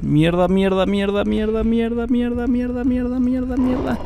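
rising panicked muttered curses; cursing under breath. Real, not acted. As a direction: repeat the curse, climbing in pitch. Panicked chase in game.

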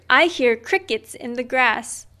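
plain reading voice

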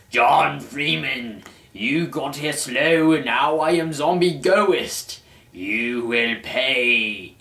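slow, drawn-out ghoulish threat; zombie menace. Acted. Zombie Gordon threatening his brother, slow and ghoulish. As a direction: stretch each word like a rising zombie.

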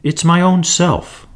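put-on a hurt fairy child sobbing; lightly voiced tearful fairy. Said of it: "sobbed the fairy child".

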